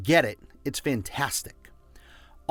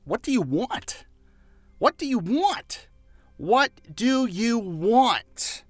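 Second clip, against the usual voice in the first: rising higher each time, last one slow; building question; 8 semitones higher, slower than usual. Real, not acted. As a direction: press the listener harder each repeat. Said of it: "let me ask you".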